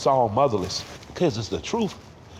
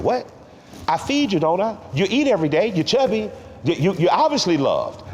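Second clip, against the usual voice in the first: indignant grandmother; 9 semitones higher than usual. Retold or imitated. Grandson retells grandmother answering his plea for love, mock indignant. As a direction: imitate an exasperated grandmother scolding a needy child.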